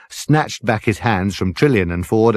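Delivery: ordinary delivery, nothing marked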